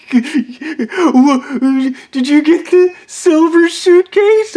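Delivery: high, slow, wide-eyed whine; squeaky startled buddy voice; mock Kevin voice. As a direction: play Kevin as a high-pitched doofus. Kevin voiced in retold call.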